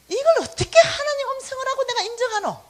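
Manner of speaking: sulky protesting inner voice; grumbling protest. Retold or imitated. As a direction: whine like you refuse to accept it.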